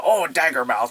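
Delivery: loud mock-Irish exclamation; blustery fake Irish voice; bad Irish accent. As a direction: ham up a cartoon Irish brogue. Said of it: "Irish and all that stuff".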